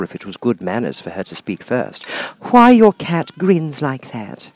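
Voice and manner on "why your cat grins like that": timid. Acted. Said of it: "said Alice a little timidly".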